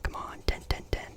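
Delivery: usual speaking voice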